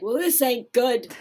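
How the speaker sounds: loud, high-pitched mock gruff husband; exaggerated comic put-on voice, pushed up and loud; Rodney Dangerfield. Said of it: "You sound like Rodney Dangerfield".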